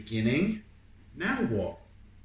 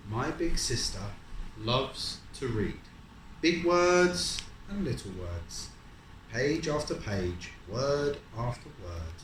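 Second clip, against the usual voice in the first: slow, lilting storybook reading; reading aloud to a child; 5 dB louder, slower than usual. A narrator's reading. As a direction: draw the child into the rhythm. Reading picture book to child.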